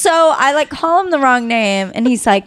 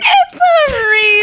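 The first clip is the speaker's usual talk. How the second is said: mock wounded correction; 13 semitones higher, 4 dB louder, slower than usual. Mocking the date's correction.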